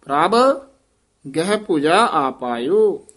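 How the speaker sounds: don't run ਪ੍ਰਭ into ਗਹਿ, lift and part them; separate ਪ੍ਰਭ. Shown as a demonstration. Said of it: "ਪ੍ਰਭ ਗਹਿ ਨਹੀਂ ਕਹਿ ਦੇਣਾ". (ਪ੍ਰਭ ਉੱਚਾ, ਫਿਰ ਠਹਿਰਾ)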